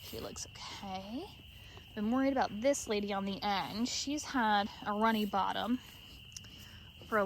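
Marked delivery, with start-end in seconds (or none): 0.0-1.2 slow relieved whisper. Real, not acted. Owner checking on a worrying ewe at night, slow whisper. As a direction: breathe it out slowly in a whisper.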